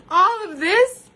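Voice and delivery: cracking with emotion; crying. Real, not acted. Vlogger shows viewers her new life, crying through it.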